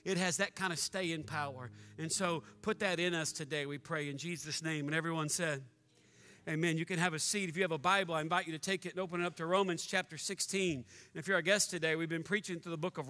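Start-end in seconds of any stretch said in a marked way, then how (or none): 0.0-6.8 prayer. Real, not acted. Opening prayer.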